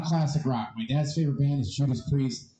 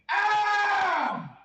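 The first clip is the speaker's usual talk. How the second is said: high shouted metal wail; metal scream. Retold or imitated. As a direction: wail it like [name]. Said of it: "He's like".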